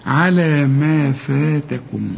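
على ما فاتكم slow, every letter kept light; very slow light reading. Shown as a demonstration. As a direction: hold each light letter slowly. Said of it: "كل الحروف دي مرققة".

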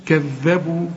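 كذبوا with the dal held doubled; tajweed shadda on كذبوا. Shown as a demonstration. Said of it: "شوف الدال هنا علشان عليها الشدة".

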